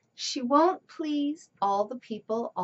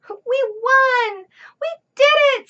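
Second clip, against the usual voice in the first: high, loud victory shout; cheering; 12 semitones higher, 11 dB louder than usual. Acted. Family celebrating the win, shouting for joy. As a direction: cheer with all you've got.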